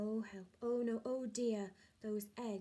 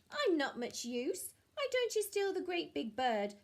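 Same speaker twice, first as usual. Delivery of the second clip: high, louder goose voice; sly goose voice; 8 semitones higher, 6 dB louder than usual. Acted. Goose steering the thieves toward the snurd, sly and high. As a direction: talk them into the snurd slyly.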